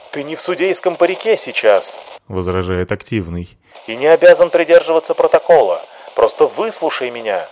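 luid, hoog en opgezweept; fiery activist. Acted. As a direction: hits de menigte op.

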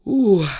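loud heaving "ukh"; effort grunt. A narrator's reading. Team yanks the lid, loud grunt of exertion. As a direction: let out one big burst of effort.

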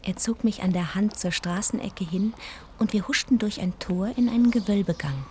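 hushed, even storytelling voice; narrating a trip remembered; story narration. A narrator's reading. First-person story narration.

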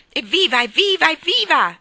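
cheering. A narrator's reading. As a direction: cheer like children let out to play.

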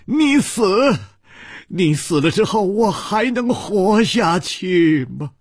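high, anguished cry, drawn out at the end; distraught outburst. A narrator's reading. Li Yuhan stamps at his wife's wish to die, distraught. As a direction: cry out in desperate protest. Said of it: "跺着脚说".

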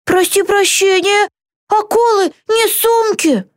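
high-pitched protesting cry; indignant yell. A narrator's reading. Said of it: "раздался вопль". (возмущённый вопль высоким голосом)

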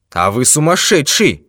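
high, loud exasperated outburst; angry shout. A narrator's reading. Detective losing patience with the old man, angry shout. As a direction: shout it in anger. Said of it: "гневно закричал сыщик".

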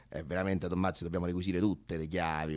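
ordinary talk, nothing marked

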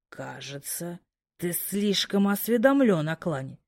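low, soft menacing growl; quiet growl. Acted. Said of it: "тихо зарычал". (тихое угрожающее рычание)

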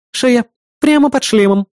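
high-pitched female assistant voice; urgent assistant. Acted. Assistant tells hero the raider's weak spot, high urgent report. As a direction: report the target briskly and clearly.

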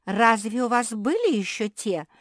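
high, loud disbelieving question; astonished. A narrator's reading. Beatrisa learns others wanted to be queen, astonished. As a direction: ask it as if stunned. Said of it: "поразилась Беатриса".

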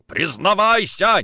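loud, high, drawn-out order; furious demand. Acted. Nikitin strangling Kochkin, furious demand. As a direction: roar the command slowly. Officer choking man, demanding confession.

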